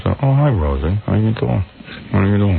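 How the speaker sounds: low, mumbled celebrity guest impression; mock a dull, low-voiced talk show guest; George Clooney. Host mocking how a guest talks to Rosie, low dull impression. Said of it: "he talks like that".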